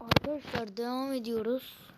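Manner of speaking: usual speaking voice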